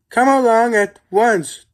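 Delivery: stern call. A narrator's reading. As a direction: call them to order sternly. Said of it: "Thomas called sternly".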